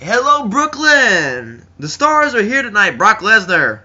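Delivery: loud, high hype-man announcement; Jon Stewart. Retold or imitated. Host greeting the Brooklyn crowd, loud announcer shout. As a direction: belt it out like an arena host. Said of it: "Jon Stewart just kinda came out and just like".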